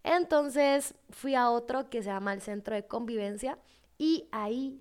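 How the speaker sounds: normal talking voice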